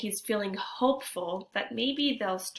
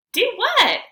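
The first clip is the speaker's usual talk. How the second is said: much higher, louder question; puzzled friend; 14 semitones higher, 12 dB louder than usual. Acted. Antonio asks Jeremy what he means, high and loud. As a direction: blurt it, puzzled.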